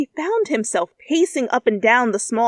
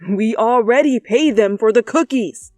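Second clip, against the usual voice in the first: louder, pushy comeback; sharp retort; 2 semitones higher, 8 dB louder than usual. Acted. Zuko arguing with uncle, louder sharp retort. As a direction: snap back at uncle. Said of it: "Zuko countered".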